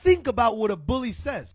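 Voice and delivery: ordinary talk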